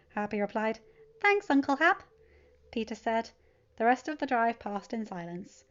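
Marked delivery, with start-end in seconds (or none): 1.2-2.0 put-on high, louder, bright-eyed teen voice; cheerful teen. Teen telling his uncle about his weekend, cheerful and high. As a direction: bounce through it like an eager kid.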